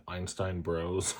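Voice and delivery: "Bros" said as the word bros, not brothers, slow and soft; imitating her son saying "Einstein Bros" as "bros"; son's pronunciation. Mother imitating her son's "Einstein Bros", slow and soft. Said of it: "he'll say, like".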